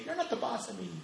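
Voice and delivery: high, soft mock child voice; imitating a kid. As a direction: mimic a defiant kid in a high voice. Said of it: "my kids are at that age".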